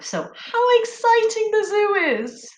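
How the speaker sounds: high, excited exclamation; exclaiming. Shown as a demonstration. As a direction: say it with wonder. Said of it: "an exclamation".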